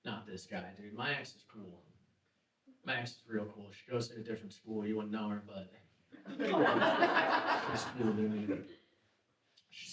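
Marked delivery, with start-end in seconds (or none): 0.1-8.6 quiet mock brag. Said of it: "mock bragging about his ex".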